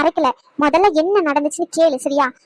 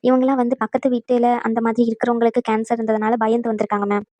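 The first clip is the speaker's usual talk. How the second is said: a lower, matter-of-fact nurse voice; brief the doctor dutifully, a touch lower; nurse reporting; 5 semitones lower than usual. Acted. Nurse briefing the doctor about the patient, low and dutiful.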